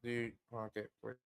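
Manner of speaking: barely audible, mic cutting out; faint voice on a broken mic; very low volume. Real, not acted. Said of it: "voice went very low in volume".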